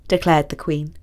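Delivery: plain reading voice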